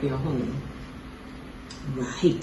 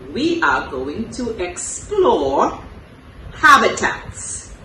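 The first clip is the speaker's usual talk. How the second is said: usual speaking voice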